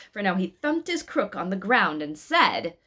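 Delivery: ordinary delivery, nothing marked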